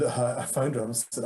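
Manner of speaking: ordinary talk, nothing marked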